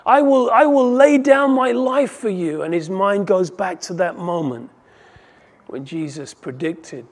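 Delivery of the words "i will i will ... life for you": Peter's vow, voice raised high and loud; emphatic vow. Retold or imitated. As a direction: declare it fiercely, swearing loyalty. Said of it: "so emphatically said".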